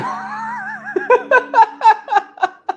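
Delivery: real whooping laugh after yelling; laughing shout. Real, not acted. Shouts "we have a show.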